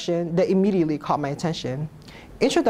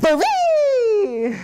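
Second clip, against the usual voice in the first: shouted; 14 semitones higher, 4 dB louder than usual. Acted.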